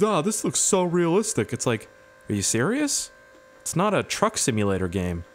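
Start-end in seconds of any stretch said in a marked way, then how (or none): 0.0-1.5 higher gushing fake awe; put-on impressed voice; mock clickbait fans. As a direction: imitate a gullible video commenter. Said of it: "And they're like".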